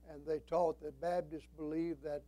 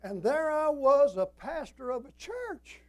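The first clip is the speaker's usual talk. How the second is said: high, loud, drawn-out protest; indignant exclamation; 9 semitones higher, 9 dB louder than usual. Retold or imitated. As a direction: cry out in mock indignation to the congregation. Mock indignation at mother's remark.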